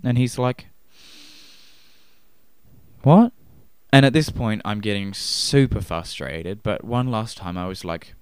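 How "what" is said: baffled loud what. Retold or imitated. Soldier's final baffled reply.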